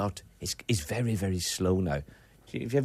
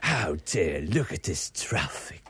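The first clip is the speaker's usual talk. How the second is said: Bruce Forsyth impression; 7 semitones lower, slower than usual. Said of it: "you never pronounce your T tonight".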